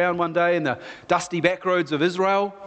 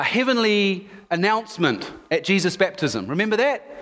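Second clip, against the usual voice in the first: normal talking voice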